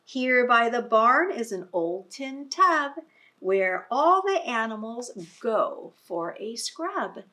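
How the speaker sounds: lively read-aloud voice; reading to children. Acted. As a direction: read it out big for a class of little ones. Reading picture book to children.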